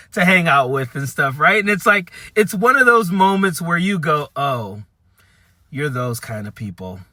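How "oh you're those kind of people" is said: low, soft, deflated "oh"; quietly taken aback; sinking realization. Retold or imitated. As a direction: say it lower and quieter, as it dawns on you. Acted moment of sinking realization.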